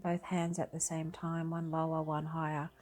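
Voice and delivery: unhurried calm voice, long pauses between phrases; guided meditation. Acted. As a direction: guide them gently through breathing and body awareness. Leads a breathing meditation.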